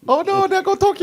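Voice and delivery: mock panic. Godzilla joke, laughing.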